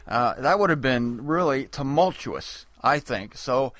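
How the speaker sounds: normal talking voice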